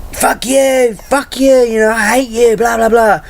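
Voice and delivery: hammed-up breakdown yelling; mock rant. Acted breakdown rant continues.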